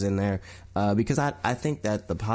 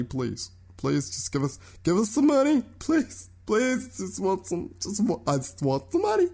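much higher, whiny pleading for money; mock begging; 9 semitones higher than usual. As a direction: beg like a greedy studio. Sony begging for Deadpool money.

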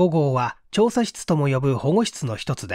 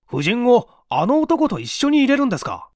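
higher, louder startled question; can't believe the order; wide-eyed surprise; 8 semitones higher, 4 dB louder than usual. A narrator's reading. Sergeant questioning the chief's order, startled and raised. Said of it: "主任は目を見張って".

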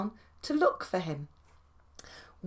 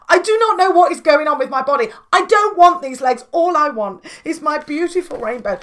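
louder, faster desperate pleading; pleading argument; 3 semitones higher, 8 dB louder than usual. Acted. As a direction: argue it out at full volume. Quarrel between tadpole and caterpillar.

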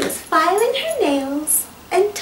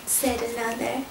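ordinary delivery, nothing marked

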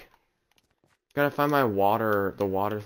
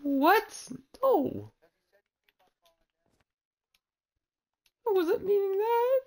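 high squeaky fake sob; playful pretend crying, pitched way up; mock crying; 3 dB louder than usual. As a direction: whine it like you're about to cry. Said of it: "now I'm gonna cry".

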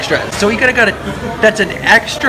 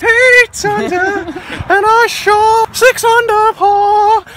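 sports announcer; 12 semitones higher, slower than usual. Pretend. Mock golf commentary.

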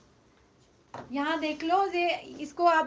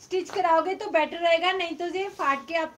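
loud, slightly raised voice for the viewers; तेज़ बोलना; 3 semitones higher, 5 dB louder than usual. Real, not acted. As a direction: speak up so the weak connection carries it. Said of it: "मैं तो बहुत तेज बोल रही हूँ".

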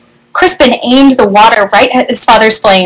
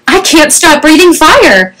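higher, mildly panicked storybook voice; light narrated alarm; 6 semitones higher than usual. A narrator's reading. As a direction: read it as a father in a panic. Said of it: "Crispin's father panicked".

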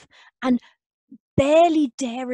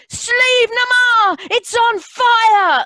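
high, slow, crying shout; shouted alarm; 11 semitones higher, 8 dB louder, slower than usual. Acted. Daughter tricking the old things, shouting that the mountain burns. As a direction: sound the alarm across the village. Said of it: "and shouted out".

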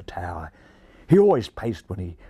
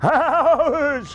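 much higher, louder cackling cry; gleeful cry; 5 dB louder than usual. A narrator's reading. Magpies cheering their boss, gleeful high cry. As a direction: crow it out in triumph. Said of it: "cried the magpies in chorus".

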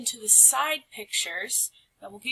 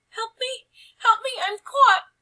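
much higher, loud panicked cries; crying for help; 14 semitones higher, 10 dB louder than usual. Acted. Mouse wife trapped by her tail, crying for help. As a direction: shriek for help, trapped and terrified.

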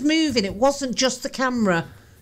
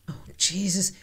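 whisper; 7 semitones lower, 8 dB quieter than usual. Real, not acted. Frightened by the doll.

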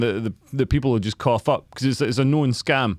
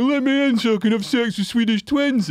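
mock drunk punter; 8 semitones higher than usual. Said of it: "banging on the door".

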